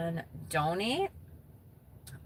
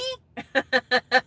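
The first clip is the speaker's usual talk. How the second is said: giggle; 5 semitones higher, 6 dB louder than usual. Real, not acted. Laughing at own joke.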